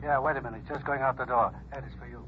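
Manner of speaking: thin, distant telephone voice; muffled desk officer answering the phone; over the phone. Acted. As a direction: sound like you're on the other end of the line. Voice heard over phone line.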